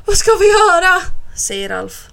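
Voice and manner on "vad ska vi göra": high, loud, desperate; panicked question. A narrator's reading. Alf asks Bo what to do on the roof, panicked. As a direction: cry out helplessly. Boy trapped with shark.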